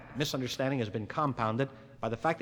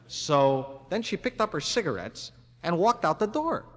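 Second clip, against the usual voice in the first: usual speaking voice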